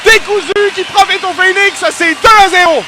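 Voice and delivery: loud, high, stretched-out goal shout; excited sports commentary. Real, not acted. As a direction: scream the goal call to the crowd. Goal call in play-by-play. (commentaire de but survolté)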